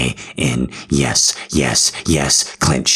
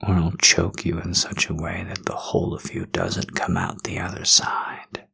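soft unvoiced threat; menacing whisper; 2 semitones higher, 7 dB quieter than usual. Dominant man threatening his partner in bed, menacing whisper.

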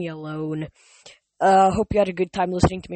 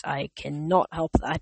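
ordinary delivery, nothing marked

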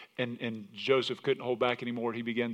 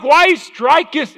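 mock old English; 17 dB louder, much slower than usual. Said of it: "the way the old English could say it".

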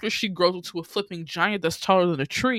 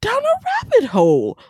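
slow, drawn-out scolding that climbs much higher at the end; mock scolding; 5 semitones higher, slower than usual. Talking back at Alice on screen, slow mock scolding. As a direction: tell Alice off, stretching every word.

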